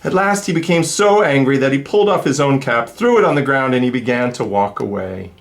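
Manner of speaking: lively, sing-song storybook reading; reading to children. A narrator's reading. Reader telling kids the peddler story, animated. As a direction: read it like to a class of little ones. Reads picture book aloud.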